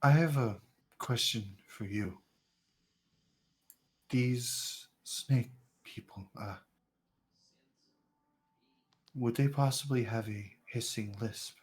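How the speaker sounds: put-on quiet, hesitant old stranger; soft blind stranger. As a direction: speak softly like a wary blind man. NPC asks quiet question.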